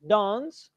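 DANCE as "dahns", long open vowel; British pronunciation. Shown as a demonstration. Teacher describing a speaker from England, British "ah" in DANCE. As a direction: give DANCE the British "ah" sound. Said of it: "England or the United Kingdom".

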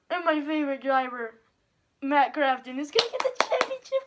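loud thrilled cheer. Real, not acted. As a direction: celebrate your driver winning. Favorite driver wins title.

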